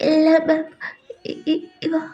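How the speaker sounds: high, broken, weepy voice; acted tearful stammer. Rejected Alia crying to ma'am, tearful high stammer.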